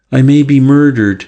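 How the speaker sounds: ordinary delivery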